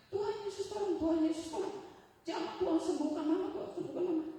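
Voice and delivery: high, urgent cries to Jesus; imitated screamed prayer. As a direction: cry out like a child praying for mama. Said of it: "Si Tessa sekarang teriak-teriak".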